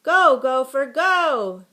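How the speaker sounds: high, very loud chant-like cheer; girls' shout. A narrator's reading. Girls cheering the gopher against the dog, shouting. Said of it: "shouted the girls".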